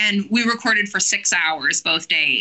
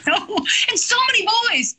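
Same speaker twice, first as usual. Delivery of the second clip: high, quick, laughing dismay; high-pitched, hurried mock complaint; amused exclamation; 13 semitones higher than usual. Real, not acted. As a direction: rush it out high in comic exasperation. Mock dismay at voices to perform.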